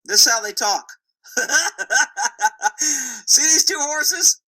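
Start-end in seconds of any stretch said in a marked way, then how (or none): 1.3-2.7 higher-pitched run of chuckles; hearty laugh. Real, not acted. Laughs after explaining horse talk. 2.8-4.4 high, slow words through laughter; laughing. Real, not acted. Still laughing at own rant.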